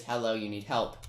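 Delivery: ordinary delivery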